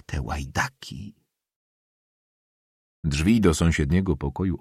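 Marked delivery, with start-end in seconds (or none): 0.1-1.1 slow, hushed confidential remark; whispered aside. A narrator's reading. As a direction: drop to a soft voice for a secret insult. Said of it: "szepnęła do ucha pani Borowiczowej".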